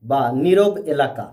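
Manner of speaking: ordinary delivery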